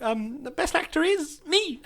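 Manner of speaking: high, drawn-out diva puppet voice; Miss Piggy. Pretend. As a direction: announce yourself coyly in a high, slow puppet voice. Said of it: "here is Miss Piggy to announce best actor".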